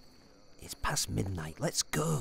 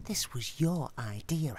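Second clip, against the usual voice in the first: ordinary talk, nothing marked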